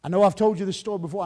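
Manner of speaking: usual speaking voice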